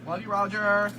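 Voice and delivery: high, loud gushing camp voice. Retold or imitated. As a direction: gush it out like a camp fanboy.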